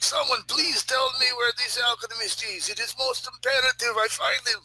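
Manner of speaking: high, pleading Russian voice; urgent Russian character. Pretend. Russian seeking the alchemist in the street, urgent. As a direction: plead insistently, pitched well up.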